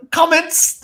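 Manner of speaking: high, gleeful squeal; giddy excitement. Retold or imitated. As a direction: blurt it out, delighted and high-pitched. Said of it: "I get all excited and I start laughing".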